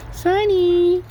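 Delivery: high loud call across the yard. Real, not acted. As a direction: call the bird's name out high. Calling out to parrot.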